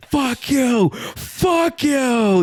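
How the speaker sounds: high, loud repeated holler; re-enacted group shout. Friends in the car all yelling at the man, re-enacted shout. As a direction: yell it like a whole carload at once.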